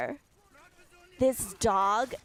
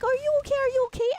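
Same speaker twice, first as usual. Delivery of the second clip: high, louder repeated checking; frantic fussing, pitched way up; mock frantic concern; 6 dB louder, much faster than usual. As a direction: imitate someone panicking over a hurt child. Man fussing over fallen child.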